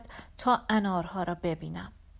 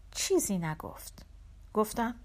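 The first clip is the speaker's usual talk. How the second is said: usual speaking voice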